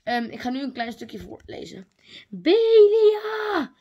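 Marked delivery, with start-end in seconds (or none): 2.3-3.7 high, loud call for a lost friend. Acted. Sister calling out for the missing Belia, loud and high. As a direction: call out her name across the room. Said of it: "riep Mo-, Limoni".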